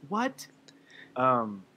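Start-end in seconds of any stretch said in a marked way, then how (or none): 0.1-0.5 retold surprise. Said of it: "and I was like".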